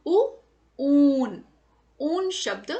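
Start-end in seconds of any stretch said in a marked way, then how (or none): 0.1-1.4 ऊ and ऊन said loudly, the ऊ held long; stretched, stressed long oo. Shown as a demonstration. Teacher drilling children on the long ऊ, loud and stretched. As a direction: pull out the long ऊ with force. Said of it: "जोर देकर थोड़ा सा उसको खींचकर".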